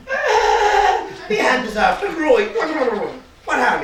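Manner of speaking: high, loud, laughing; laughing loud and high at his grown hand; laughing delight. Acted. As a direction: laugh through the words, tickled. Doctor laughing at his big hand.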